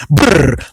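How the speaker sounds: low lip-buzz brrr of tyres on the line; rumble strip brrr. Retold or imitated. Imitating the rumble of tyres over the lane line. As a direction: buzz your lips like tyres on bumps. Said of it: "you're hearing it, like".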